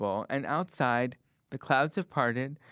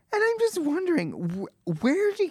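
much higher, stammering confusion; baffled. Acted. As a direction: squeak out a bewildered question. Baffled reaction to neighbor.

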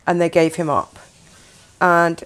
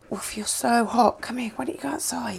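soft, breathy whisper to a pet; soft cooing; 4 semitones higher, 6 dB quieter than usual. Retold or imitated. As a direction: coo gently at the dog, almost whispering. Boyfriend's mum cooing at dog.

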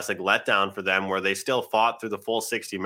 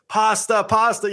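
excited commentator's call, much higher and louder; Jack Edwards; 12 semitones higher, 5 dB louder, slower than usual. Retold or imitated. Host imitating a hockey announcer, high loud call. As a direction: shout "pasta" as PAH-sta like a play-by-play man. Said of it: "if you watch Jack Edwards".

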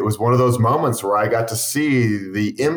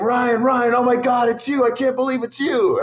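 imitating excited yelling; 13 semitones higher than usual. Fan spots his YouTube idol riding, imitated excited high yell. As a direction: mimic a thrilled fan calling out in a high voice. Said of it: "start yelling my name".

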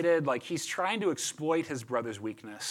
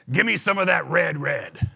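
loud, thick-voiced Southern caveman; a hint of deep Southern oaf; 6 dB louder than usual. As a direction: blurt it like a dim, hungry caveman. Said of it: "such a deep voice or a southern accent".

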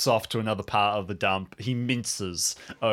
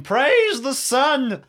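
high, loud, long-drawn proclamation; exultant cry; 7 dB louder, much slower than usual. Acted. Hermit atop a trash pile, exultant cry. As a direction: cry it out with stretched, triumphant words. Stands on trash, arms in air.

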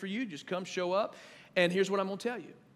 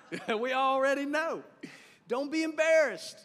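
teasing laugh; 7 semitones higher, 3 dB louder than usual. Real, not acted.

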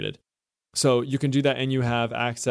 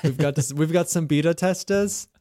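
bad mock Australian accent, higher; hammed-up Australian accent, pitched up; 5 semitones higher than usual. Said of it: "I know that's a horrible Australian accent".

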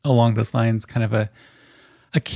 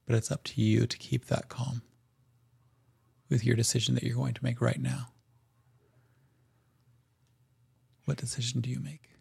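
softer, hushed narration leading to a choice; soft scene narration; 7 dB quieter, faster than usual. Acted. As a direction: hush the table before the big decision.

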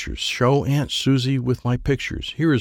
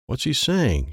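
ordinary delivery, nothing marked

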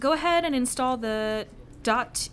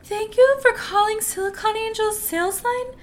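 high, loud chirpy phone bot; phone menu voice; 11 semitones higher, 12 dB louder than usual. Acted. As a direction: chirp it like a call-center robot. Automated sales line voice.

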